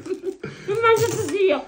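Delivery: talking through a mouthful, laughing; mouth full. Real, not acted. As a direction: say it with cheeks stuffed full. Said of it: "C'ha la bocca piena".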